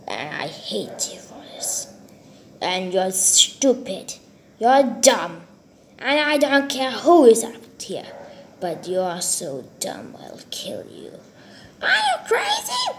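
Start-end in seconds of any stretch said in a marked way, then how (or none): 0.1-1.9 whispered threat. Acted. Muttered threat at Billy. 8.6-11.2 soft unvoiced menace; whispered threat. Gunman whispering a threat at Billy. 11.8-12.9 high frightened shout; panicked cry. Acted. Threatened man crying out at the madman. Victim cries out.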